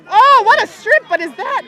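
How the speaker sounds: sudden high yelling burst of commentary; excited shout. Real, not acted. Commentator yelling at a dramatic strip. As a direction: yell it out as the play happens. Commentator reacting to a big play.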